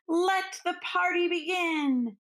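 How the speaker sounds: bright, joyful party call; a merry crowd cheering in a storybook; cheerful cry. Acted. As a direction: throw the doors open with delight. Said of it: "everyone cried".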